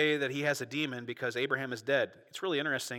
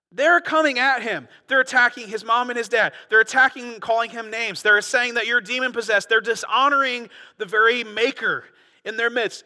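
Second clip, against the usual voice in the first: loud, rising pulpit fervor, louder; preaching at full force; 8 semitones higher, 10 dB louder than usual. Acted. Preacher rousing his congregation, full-throated and loud. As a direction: build the outrage to the back row.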